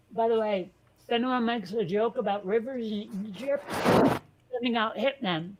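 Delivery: talking through a mouthful of food; words said round a bite of food; mouth full. Real, not acted. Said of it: "I was talking with my mouth full".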